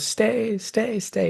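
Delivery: lightly voiced high fading echo; mock echo of a boy's fading plea. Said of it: "his voice echoes".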